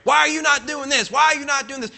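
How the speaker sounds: high, pushy mock scolding; hammed-up nagging at a newcomer; nagging busybody. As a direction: needle them like a nosy church member. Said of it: "and get on them".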